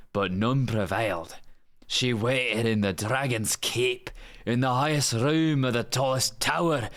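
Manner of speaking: lilting Scottish storybook narration; full-on Scottish accent. Acted. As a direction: read the fairy tale as a full-on Scot. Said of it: "Just like a full-on Scot".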